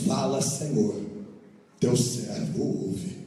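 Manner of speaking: low and slow, hushed reverence; calm reverent answer. Shown as a demonstration. Eli teaching Samuel how to answer God, low slow and reverent. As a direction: answer humbly, slowly. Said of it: "diga dessa maneira".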